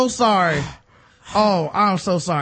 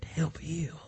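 softer hushed prayer whisper; 3 semitones lower, 12 dB quieter than usual. Pretend. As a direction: breathe it out like a secret prayer. Mock Ray Lewis prayer.

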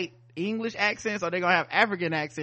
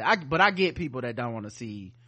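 ordinary delivery

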